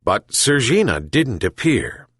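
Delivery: ordinary delivery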